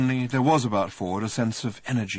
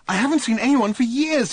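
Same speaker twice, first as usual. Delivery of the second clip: raised, louder bewildered castaway; high, overwhelmed Arthur blurting it out; voiced Arthur, emotional; 14 semitones higher, 6 dB louder than usual. Lonely Arthur greeting Ford, emotional and high. Arthur speaks after years alone.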